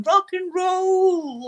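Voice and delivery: high, slow, drawn-out rock-star yell; rock and roll shout. Retold or imitated. Fan mimicking [name]'s rock cry, high and stretched out. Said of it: "like that".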